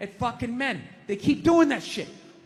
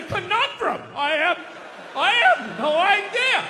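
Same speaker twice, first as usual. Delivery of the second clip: mock dumbfounded men.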